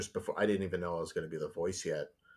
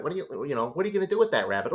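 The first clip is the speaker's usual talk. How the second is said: Bugs Bunny voice; 8 semitones higher, 4 dB louder, faster than usual. Retold or imitated. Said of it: "I did Bugs talking to Beaky".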